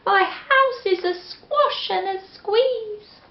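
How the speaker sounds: put-on high, fretful old lady lament; old lady at her wits' end; old lady crying out. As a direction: wail it as a flustered old lady. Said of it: "The little old lady cried".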